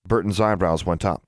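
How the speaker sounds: ordinary delivery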